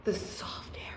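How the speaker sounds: soft teasing whisper. Real, not acted. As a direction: breathe it out as a playful aside. Teasing aside to the viewer.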